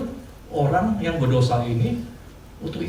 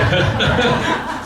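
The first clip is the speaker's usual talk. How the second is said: big laugh, higher and louder; 9 semitones higher, 5 dB louder than usual. Real, not acted. Pastor admitting he is scared too, loud high laugh. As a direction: burst out laughing.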